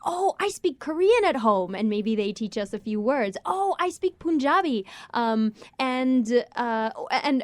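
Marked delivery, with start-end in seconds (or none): 0.1-1.7 higher, imitating a child; child's voice. Musician quoting a child at a concert, higher imitated child voice. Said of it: "children who come to our concerts and say". 3.4-4.9 higher, imitating a child; child's voice. Musician quoting a child at a concert, higher imitated child voice. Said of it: "children who come to our concerts and say".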